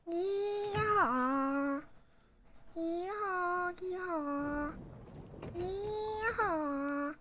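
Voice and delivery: slow, hammed-up Texan drawl; mock cowboy. Streamer pretending to be a Texas farm cowboy, hammed-up drawl.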